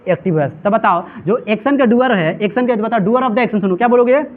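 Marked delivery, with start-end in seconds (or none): none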